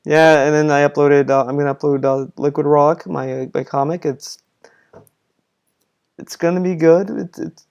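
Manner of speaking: unenthused, trailing off softly; sounds like a chore. Retold or imitated. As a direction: mumble it with halting pauses, as if bored by it. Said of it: "he just sounded as if it was a chore to him".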